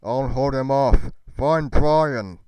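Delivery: loud urgent command, buying time; desperate shout. Retold or imitated. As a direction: yell it as he turns to fight. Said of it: "The last thing my father ever said".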